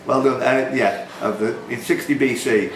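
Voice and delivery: normal talking voice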